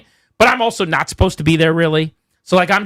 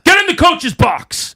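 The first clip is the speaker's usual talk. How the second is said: much higher, slow, loud mock bark of an angry referee; imitating referee's shout; 12 semitones higher, 3 dB louder, slower than usual. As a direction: belt it out like the official ordering him off. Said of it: "He goes".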